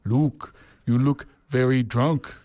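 slow South African boss voice; South African accent. Retold or imitated. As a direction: imitate a South African manager. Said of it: "do his South African accent".

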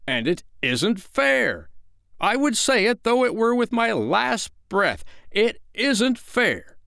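very high, drawn-out wail of unfairness; bursting indignation. A narrator's reading. Father bursting with hurt pride, wailing indignantly. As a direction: protest as though with your last breath. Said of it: "His proud heart was nearly bursting".

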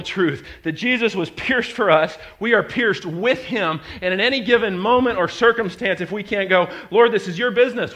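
loud, high fervent sermon voice; preacher crying out to the Lord; preaching at full force. Acted. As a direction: pour it out like a prayer shouted.

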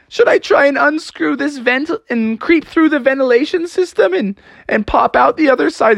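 put-on high, fretful kid voice; anxious pleading. Froggy asks the bystanders about the vents, high and anxious. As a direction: babble an escape plan anxiously. Frog frantically suggests escape.

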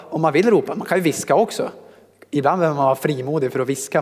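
usual speaking voice

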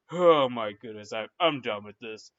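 fed-up pastor. Retold or imitated. Pastor closing the book, low mock exasperation. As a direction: lightly act a priest giving up. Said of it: "he has a look on his face".

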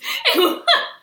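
high, loud burst of real laughter. Real, not acted. Laughing in disbelief at the coworker's blunt question. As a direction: let a real laugh break out.

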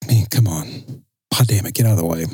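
low, under-the-breath grumble; exasperated grumble. Retold or imitated. Griping low at people blocking the lane. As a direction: grumble it low, fed up with the slowpokes.

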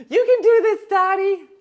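high, loud cheer; cheerleader voice. Acted. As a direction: squeal it like a cheerleader. Cheering Dottie on.

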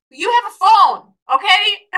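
high, loud insistence; emphatic exhortation. Real, not acted. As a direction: drive the point home loudly.

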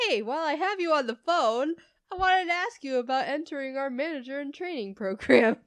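imitating a suddenly perky manager; chirpy manager pivot. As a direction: switch brightly into a pitch. Manager's sudden cheerful switch on phone.

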